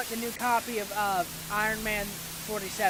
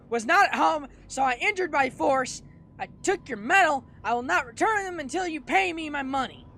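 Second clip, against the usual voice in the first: loud, high, forceful note reading; hammed-up indignant declaration; irate neighbor; 6 semitones higher, 9 dB louder than usual. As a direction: hammer out each demand loudly.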